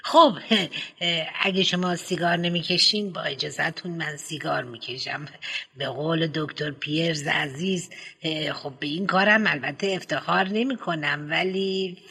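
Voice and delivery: usual speaking voice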